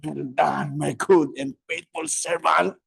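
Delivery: the Lord praising. Retold or imitated. The Lord praising a faithful servant, slow and solemn. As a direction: bless them slowly. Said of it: "pag nakita kayo ng Lord".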